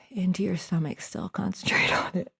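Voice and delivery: hushed whispered cue. Real, not acted. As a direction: drop to a whisper mid-instruction.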